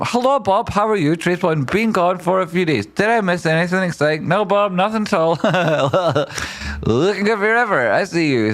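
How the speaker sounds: high, bright mock chat-reader voice; high playful voice for a viewer's message; put-on chat voice.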